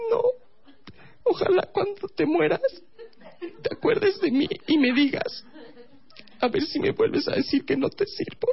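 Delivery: tiny falsetto mock sulk; offended girlfriend. Girlfriend offended at being called useless, mock sulky falsetto. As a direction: pout in a very high put-on female voice. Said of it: "se enojó, por supuesto que se enojó".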